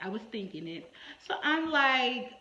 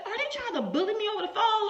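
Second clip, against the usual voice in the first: high, fast, laughing mock shock; mock indignation, laughing at herself; pearl-clutching outrage; 10 semitones higher, faster than usual. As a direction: gasp it out like a scandalized lady clutching her pearls. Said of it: "I clutched my pearls".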